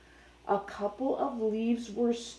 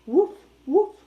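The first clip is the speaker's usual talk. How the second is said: higher, louder imitated dog bark; 5 semitones higher, 8 dB louder than usual. Shown as a demonstration. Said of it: "You hear the dog?".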